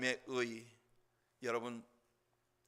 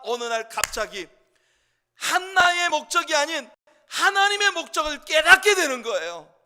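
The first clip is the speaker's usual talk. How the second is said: loud, high impassioned preaching; full-force preaching; 16 dB louder than usual. Acted. Pastor preaching about Hannah's prayer, full force. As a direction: drive the point home to the congregation.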